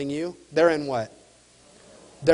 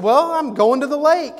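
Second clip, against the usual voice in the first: upbeat reply; 9 semitones higher, 5 dB louder than usual. Retold or imitated. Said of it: "And I said".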